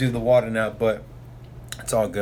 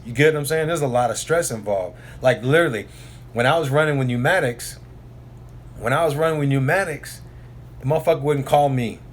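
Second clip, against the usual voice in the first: ordinary delivery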